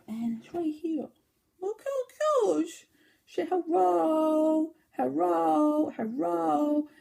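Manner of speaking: high, slow, sing-song cooing at a puppy. Real, not acted. Knitter cooing at her knitted dachshund toy, high baby talk.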